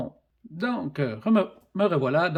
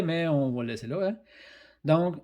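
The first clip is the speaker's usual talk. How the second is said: ordinary delivery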